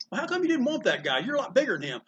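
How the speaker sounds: put-on high, puzzled child asking; mock a curious young fan; lightly voiced puzzled kid. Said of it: "kids would walk up to me after the show and says".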